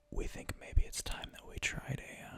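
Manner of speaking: a low, secretive whisper; hint at the plan like a secret; sinister whisper. Acted. Villain hinting at a plan.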